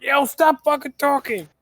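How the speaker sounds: high-pitched mock scolding; mock angry yell. Mimicking a rule enforcer silencing people, mock angry yell.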